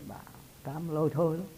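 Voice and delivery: ordinary talk, nothing marked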